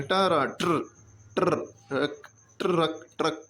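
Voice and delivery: ट and रा merged into a rolled ट्र, then ट्रक; teacher builds ट्रक from टरा to ट्र to the whole word; blending sounds. Shown as a demonstration. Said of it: "ऐसे पढ़ते हैं".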